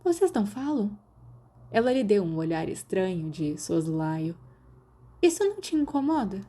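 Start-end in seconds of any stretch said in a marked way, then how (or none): 0.0-1.0 put-on high, lively girl's voice; a curious schoolgirl pressing for gossip; Marlene's voice. A narrator's reading. 5.2-6.4 put-on high, lively girl's voice; a curious schoolgirl pressing for gossip; Marlene's voice. A narrator's reading.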